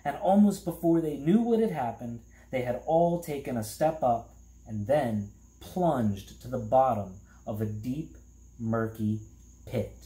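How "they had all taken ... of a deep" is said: usual speaking voice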